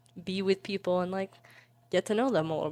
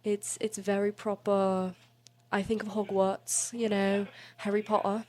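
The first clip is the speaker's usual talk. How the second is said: proper British accent; 3 semitones higher than usual. Shown as a demonstration. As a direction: put on a proper tea-and-crumpets British accent. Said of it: "this accent is very proper".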